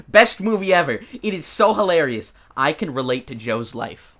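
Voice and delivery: put-on southern accent. Podcaster reads a fan's movie review in Southern accent. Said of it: "these reviews have, like, a southern accent".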